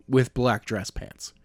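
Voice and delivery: ordinary delivery, nothing marked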